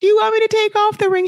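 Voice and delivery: hammed-up panicked repeat of the question; frantic repeat. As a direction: push the repeat into frantic imitation. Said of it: "like, so upset".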